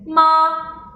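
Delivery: long level "ma"; steady "ma" with no glide; first tone. Shown as a demonstration. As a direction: hold "ma" high on one straight pitch. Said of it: "Once again".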